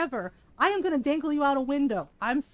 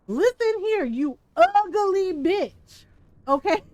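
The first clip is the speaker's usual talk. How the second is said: mock threat; 4 semitones higher, 6 dB louder, slower than usual. Threatening Bianca in mock fury.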